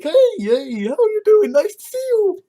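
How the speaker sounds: high, loud fake-friendly hello; mock chummy greeting. Mocking Nazis being chummy.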